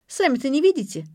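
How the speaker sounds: put-on sharp teenage comeback; testy retort. A narrator's reading. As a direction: throw it back irritably. Teenager snaps back at grandmother.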